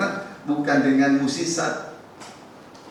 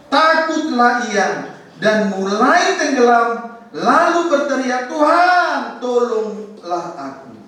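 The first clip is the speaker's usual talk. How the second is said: Peter crying for help; 9 semitones higher, 3 dB louder, slower than usual. Acted. Sinking Peter cries out.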